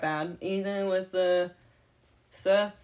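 ordinary delivery

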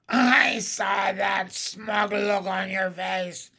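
slow witch shout; accusing hag. A narrator's reading. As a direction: accuse him as a vengeful crone. Hag berating absent victim.

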